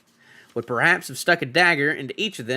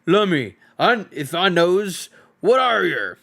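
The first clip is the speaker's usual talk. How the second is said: slow, louder troll voice; puzzled troll; 5 semitones higher, 4 dB louder than usual. Acted. Troll peers at the strange hobbit, slow and puzzled. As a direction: drag the words out like a slow-witted troll.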